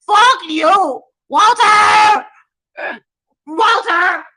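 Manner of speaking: loud, high shouted curses; furious screaming; yelling. Real, not acted. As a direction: yell for Walter across the house. Shouting for husband.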